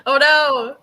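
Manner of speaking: loud, high cry of dismay; playful alarm. Pretend. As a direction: wail it out, mock-horrified. Alarmed at the game scene.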